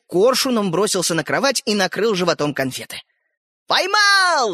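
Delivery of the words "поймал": high triumphant cry; excited shout. A narrator's reading. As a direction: shout it out as a catch. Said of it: "закричал он".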